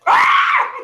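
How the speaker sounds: sudden high, loud yelp; real high-pitched shriek of fright; startled scream. Real, not acted. Host startled by a fake bug, screaming.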